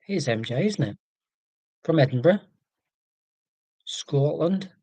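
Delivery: a hint of a bad Scottish accent; mock Scottish lilt, barely there; terrible Scottish accent. Greeting a viewer from Edinburgh in a lightly put-on Scottish accent. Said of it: "I did a really terrible Scottish accent".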